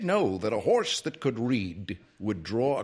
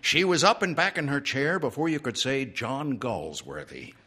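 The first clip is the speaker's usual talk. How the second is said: plain reading voice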